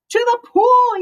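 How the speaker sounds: high, loud shout; yelled. Acted. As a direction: yell it out to the whole crowd. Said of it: "yelled the firemen".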